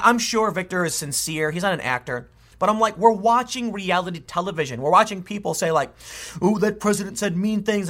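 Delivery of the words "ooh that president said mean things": higher whiny mock upset; hammed-up wounded complaint; mock near-crying. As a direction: pretend you're almost in tears, mockingly. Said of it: "and almost crying".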